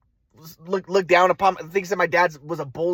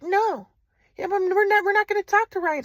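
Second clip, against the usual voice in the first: mom's voice; 11 semitones higher than usual. Retold or imitated. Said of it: "my mom was like".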